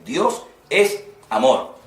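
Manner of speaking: "Dios es amor" spoken slowly, each word weighted; slow emphatic. Shown as a demonstration. Preacher repeating the verse to the students, slow and weighty. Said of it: "es un versículo que está clarísimo".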